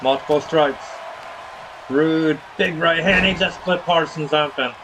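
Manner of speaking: punchy ringside commentary; wrestling play-by-play. Acted. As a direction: call each wrestling move as it lands. Calls wrestling match play-by-play.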